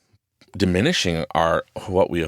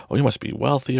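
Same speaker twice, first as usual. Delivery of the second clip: impressed onlookers; 7 semitones higher than usual. Retold or imitated. Imitating impressed onlookers guessing he's rich.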